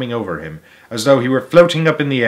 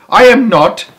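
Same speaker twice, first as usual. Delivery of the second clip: loud, high protest; indignant cry; 10 semitones higher, 8 dB louder than usual. A narrator's reading. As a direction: insist it fiercely. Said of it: "cried Charlie".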